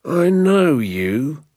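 high, slow, threatening drawl; menacing keeper. Acted. Gamekeeper threatening the poachers, slow menacing voice. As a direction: loom over them, every word a threat.

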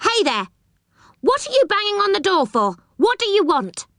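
very high, quick character voice; high-pitched, indignant surprise; surprised calling out. A narrator's reading. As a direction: call out startled through a door. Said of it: "A surprised voice called out".

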